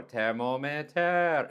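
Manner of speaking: slow it down and spell out each syllable. Retold or imitated. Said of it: "I try to say slower".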